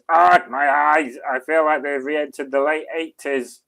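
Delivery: hammed-up agony over a dreadful sight; mock anguished cry. Streamer acts out a viewer's chat message, mock anguished cry.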